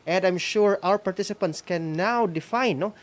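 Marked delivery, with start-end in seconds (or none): none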